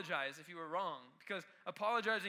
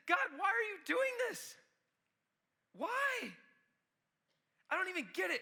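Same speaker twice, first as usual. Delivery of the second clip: much higher, louder, pleading protest; anguished cry at God; 11 semitones higher, 4 dB louder than usual. Retold or imitated. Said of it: "he starts getting mad at God".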